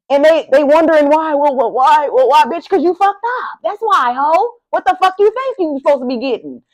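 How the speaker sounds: high, indignant mock scolding; scolding the people who hurt Virgo; heated rant. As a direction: tell them off with scorn. Angry rant at absent people.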